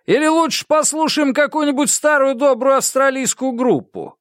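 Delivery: very high-pitched emcee pitch to the crowd; goading DJ. A narrator's reading. DJ offers the crowd an Australian band instead, goading. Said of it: "Он вышел на сцену и сказал".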